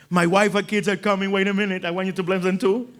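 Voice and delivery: pushy excited voice; eager request. Retold or imitated. Eager gentiles quoted.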